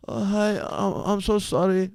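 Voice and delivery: quietly mimicked sheepish girl; meek apology. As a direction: imitate her small, apologetic voice.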